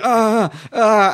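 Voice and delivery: mock pained ahs; mock dying groans. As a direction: play the dying civilian for laughs. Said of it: "and they go".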